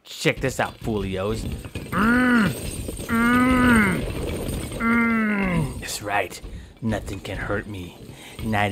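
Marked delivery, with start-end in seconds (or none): 1.9-5.8 loud, high straining grunts; straining grunts while lifting; straining hum. Pretend. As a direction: push hard with high loud hums. Straining to smash building.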